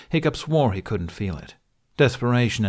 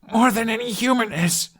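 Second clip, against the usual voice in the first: put-on higher earnest boy voice; young Hiccup voice; 14 semitones higher than usual. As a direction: insist as the young hero. Hiccup defending the dragon.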